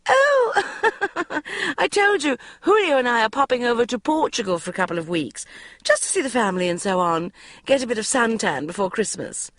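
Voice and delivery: high, quick, breezy with a laugh; mother laughing off the question; airy mother. A narrator's reading. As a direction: brush it off like a flighty mum. Mother voiced, laughing airily.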